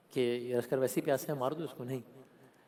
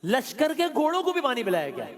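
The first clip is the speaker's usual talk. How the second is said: high, loud sermon climax; rising preacher's cry; 10 semitones higher, 7 dB louder than usual. Real, not acted. Preacher to mourners, building to a loud cry. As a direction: cry it out to the gathering. Preacher stressing Imam's generosity.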